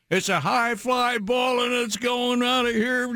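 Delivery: yelling play-by-play announcer; high, shouted over-the-top home run call; screaming home run call. Retold or imitated. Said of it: "I hate that".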